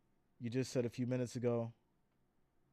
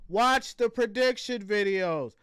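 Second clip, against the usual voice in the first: exasperated; 7 semitones higher, 7 dB louder, slower than usual. Real, not acted. Exasperated reply repeated.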